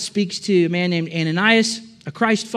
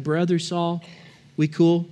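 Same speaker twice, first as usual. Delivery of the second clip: softer, hesitant greeting; timid; 3 semitones lower, 4 dB quieter than usual. Acted. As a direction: tiptoe in, unsure of a welcome. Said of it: "a little bit fearful".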